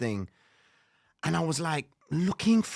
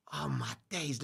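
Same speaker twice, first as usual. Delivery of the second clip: softer, low mumble to yourself; muttered dismay; 3 semitones lower, 10 dB quieter than usual. Retold or imitated. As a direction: mutter it in dread. Muttered to himself.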